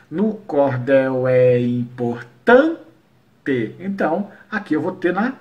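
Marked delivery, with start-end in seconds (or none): 0.1-3.7 very slow and lower, syllable by syllable; syllable counting. Shown as a demonstration. Teacher showing the seventh poetic syllable, slow separated scansion. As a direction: scan each syllable, leaning on the stressed "tan". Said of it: "a sétima sílaba poética".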